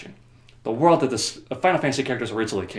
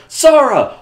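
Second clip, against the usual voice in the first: much higher, loud dramatic call; mock cry out; 12 dB louder than usual. Kairi calling for Sora, loud dramatic cry. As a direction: cry his name to the shadow.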